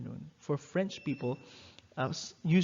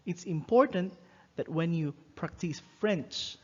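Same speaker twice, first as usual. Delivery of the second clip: usual speaking voice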